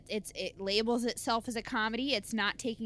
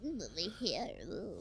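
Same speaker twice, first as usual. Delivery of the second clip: put-on snooty girl voice; 4 semitones lower, 10 dB quieter than usual. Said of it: "She was like".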